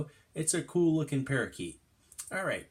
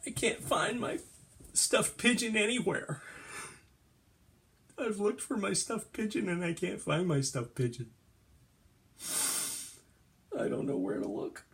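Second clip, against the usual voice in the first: high, plaintive lament; put-on sad, higher-pitched voice; acted sad; 6 semitones higher than usual. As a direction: whine mournfully over a lost toy. Sad about lost stuffed pigeon.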